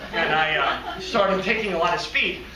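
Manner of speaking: ordinary delivery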